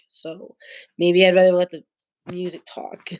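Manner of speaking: usual speaking voice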